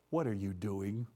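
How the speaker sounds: soft, low, measured question; still small voice. Retold or imitated. The Lord questioning a hiding trucker, still small voice. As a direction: ask it low and hushed, like an inner voice. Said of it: "still small voice".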